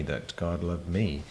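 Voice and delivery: normal talking voice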